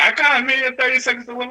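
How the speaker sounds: high fast quote; high quoting voice. Retold or imitated. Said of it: "And then she was like".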